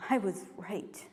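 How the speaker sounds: low, soft admission; lacking courage. Acted. Poet recalling lost courage to speak up, subdued. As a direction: let it sink low, too unsure to speak up. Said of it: "didn't have the courage to say".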